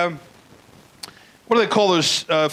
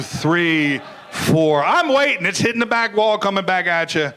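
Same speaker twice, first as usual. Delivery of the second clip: ordinary talk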